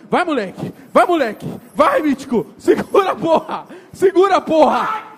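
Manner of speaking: yelling encouragement. Real, not acted. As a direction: scream at him to hang on. Cheering friend on mechanical bull.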